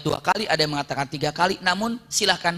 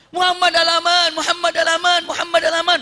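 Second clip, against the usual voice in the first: high, loud repeated hailing; shouting; 10 semitones higher, 6 dB louder than usual. Acted. Pursuer hails Muhammad for safety, acted shouting. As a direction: chant it out like a plea from afar. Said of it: "sekarang gayanya sambil teriak".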